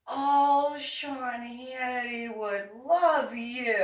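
high, slow, swooning "oh" after a gasp; a breathless fan pushing her idol; gushing neighbor. Retold or imitated. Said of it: "she's like".

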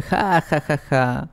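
a policeman laughing down at a prisoner; little taunting laugh that fades out; mocking laugh. A narrator's reading. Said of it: "se ríe y dice".

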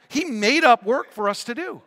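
high, loud, animated pulpit voice; full-force preaching storytelling. Real, not acted. Preacher warning about lining up at the clock, loud and animated. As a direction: belt the story out to the room. Preacher drives home the lesson.

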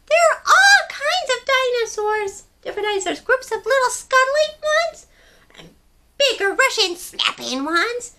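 high, loud curious little penguin voice; a tiny penguin full of questions. Acted. Story reader voicing small penguin Pipkin asking big questions. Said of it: "he said to his mama".

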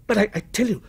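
high, halting protest; distressed cry. A narrator's reading. Victim protests to the menacing stranger, distressed cry. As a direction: cry out while faltering. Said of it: "cried Walter, clutching".

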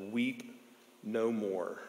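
hushed, tender comfort from the elder; gentle elder. Retold or imitated. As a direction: softly soothe a weeping man. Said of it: "looks over to him and says".

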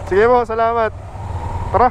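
ordinary talk

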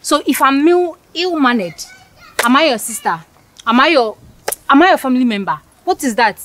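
put-on a haughty girl furious at being called ill-mannered; offended outburst. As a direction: tear into him for insulting you. Heated quarrel, offended girl.

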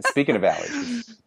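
laughing out the joke. Real, not acted. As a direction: quip it through a laugh. Joking about her lost voice.

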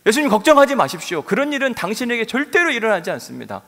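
loud, high confident vow; bold promise. Retold or imitated. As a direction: swear it with bravado. Peter's bold promise voiced. (베드로가 예수님께 큰소리로 장담하는 말투)

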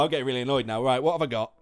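ordinary delivery

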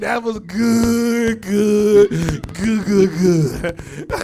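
loud, drawn-out savoring shout; hammed-up unrepentant delight; gleeful relish. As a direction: belt out each repeat with relish. Relishing retort about eating lobster.

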